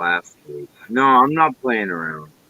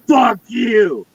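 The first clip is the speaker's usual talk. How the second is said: high, loud yell; real angry outburst; furious shout; 11 semitones higher, 4 dB louder than usual. Real, not acted. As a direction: blow up and scream it. Shouting at absent lighter thief.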